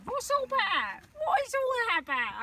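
a lightly put-on Cockney grumble; a touch of Cockney exasperation. Real, not acted. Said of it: "I've got bloody Cockney now".

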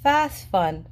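usual speaking voice

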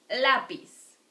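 "lápiz" with a loud, strong first syllable; next-to-last syllable stressed. Shown as a demonstration. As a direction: hit LÁ in "lápiz". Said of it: "in the next to last syllable".

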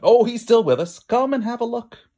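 a hint of a pleased farm wife calling over; lightly voiced farm wife. Farmer's wife voiced in story.

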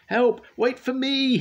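very high, loud desperate call; cry for help. A narrator's reading. Workman left behind calling to the twins, loud high cry. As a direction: scream after the leaving train. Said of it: "he cried".

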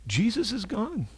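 normal talking voice

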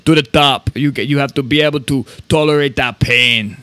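mild Austrian accent, lightly put on; a half-hearted Arnold with Austrian accent; bad Arnold impression. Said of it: "Worst Arnold impression".

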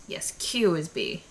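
normal talking voice

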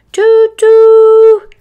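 loud, high-pitched toot-toot; train whistle. Acted. Imitating the elf train's whistle.

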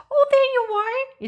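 very high chirpy bird. A narrator's reading. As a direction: greet a lost one in a high bird voice. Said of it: "it explained when it saw the chicken".

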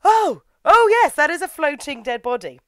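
high, loud ironic "oh yes"; mock sarcastic agreement. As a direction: drip sarcasm, pitch jumping up. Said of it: "you're like".

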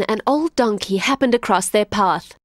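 ordinary delivery

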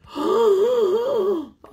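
big high gasp of alarm; shocked gasp. Acted. Gasping in shock at the rabbit swallowing the bee.

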